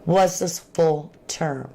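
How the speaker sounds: ordinary talk, nothing marked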